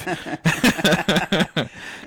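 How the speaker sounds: high cartoon villain cackle. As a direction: cackle like Skeletor. Skeletor cackle after the quote.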